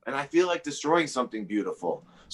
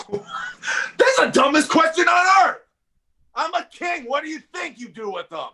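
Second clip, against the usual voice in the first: mocking shout; 4 dB louder than usual. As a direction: scoff and yell it at him. Mocking the interviewer's question.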